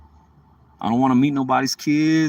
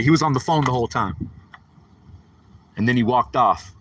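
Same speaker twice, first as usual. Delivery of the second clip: normal talking voice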